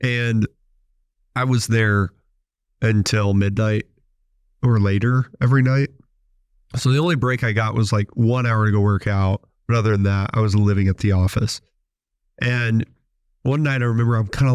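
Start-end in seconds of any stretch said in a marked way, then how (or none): none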